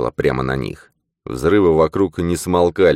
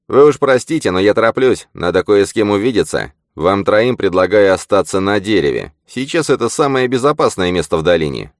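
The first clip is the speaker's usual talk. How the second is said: mocking; 5 semitones higher, 4 dB louder than usual. A narrator's reading. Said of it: "Поглумившись".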